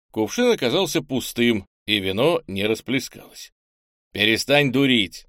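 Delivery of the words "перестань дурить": ordinary delivery, nothing marked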